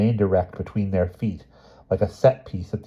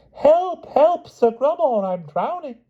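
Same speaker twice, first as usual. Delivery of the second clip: the queen pretending to drown to win Grummore; fake drowning; slower than usual. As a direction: overact a drowning lady.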